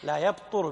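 يبطر said softly as yabtur; damma on ta. Shown as a demonstration. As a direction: quietly confirm يبطر with u on the ta. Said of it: "بضم الطاء". (نطق يبطر بضم الطاء بصوت منخفض)

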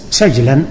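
سجلا as "sajlan", the j left unvowelled; sukun on jim. Shown as a demonstration. Teacher answering a student's question on vowelling, soft سجلا as "sajlan". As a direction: say سجلا softly, closing the first syllable on j. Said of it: "بإسكان".

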